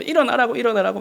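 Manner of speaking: impatient dad nagging 일어나라고; nagging to get up. Retold or imitated. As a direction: nag the kid awake, pitch up on 일어나라고. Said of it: "막 잔소리를 하고".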